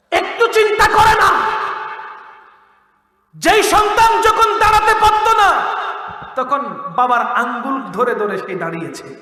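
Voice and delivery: high, loud fiery sermon; fiery preacher shouting the verse; full-force preaching. Acted. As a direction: thunder the warning at the crowd.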